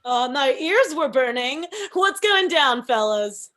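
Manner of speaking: loud, brash hello; loud brash entrance. Acted. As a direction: barge in loud and cocky. Alyssa barges in loudly.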